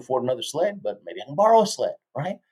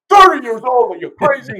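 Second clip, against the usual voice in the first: much higher and louder disbelief at the age; incredulous coach; 12 semitones higher, 10 dB louder than usual. Retold or imitated. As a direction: blurt it out like the idea is absurd. Coach reacts to thirty-year-old.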